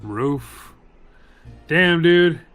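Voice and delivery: normal talking voice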